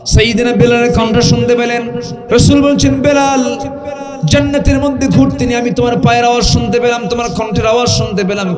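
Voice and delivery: high, loud storytelling with a quickening rush; full-force preaching. Real, not acted. Preacher retelling the Prophet addressing Bilal, full-force preaching. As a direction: ring out the name, then race on high.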